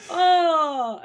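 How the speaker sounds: high squealing disgusted laughs; horrified laughter. Real, not acted. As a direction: laugh in real revulsion. Genuinely disgusted by zombie spiders.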